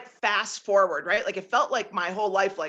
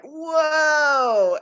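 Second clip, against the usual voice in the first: stunned whoa; 7 semitones higher, 7 dB louder than usual. Retold or imitated. Awed reaction to landlord's story.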